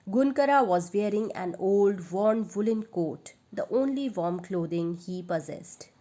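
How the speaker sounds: ordinary delivery, nothing marked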